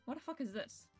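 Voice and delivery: normal talking voice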